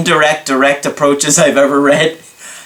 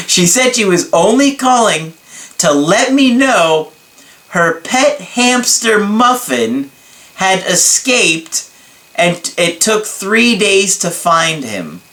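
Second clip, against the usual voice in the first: higher, slow, amused read; 6 semitones higher than usual. Real, not acted. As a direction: read it holding back laughter. Reading a funny email.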